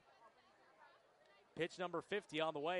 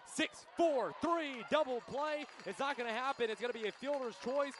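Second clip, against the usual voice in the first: excited play call; 5 semitones higher than usual. Real, not acted.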